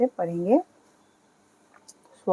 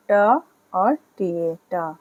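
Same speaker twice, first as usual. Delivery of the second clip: slow sounding out; slower than usual. Shown as a demonstration. Teacher sounding out the letter slowly for students. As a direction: slowly spell out ट, टी ए, टा. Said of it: "sound आएगी".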